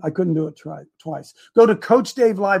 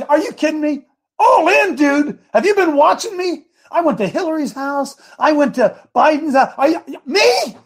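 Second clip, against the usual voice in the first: indignant Peter; 7 semitones higher than usual. Retold or imitated. Peter protests to Jesus.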